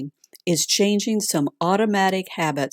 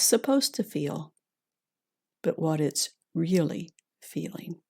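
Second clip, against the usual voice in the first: calm grounding exercise; 3 dB quieter than usual. Acted. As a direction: gently guide them inward, unhurried. Leads a heart meditation.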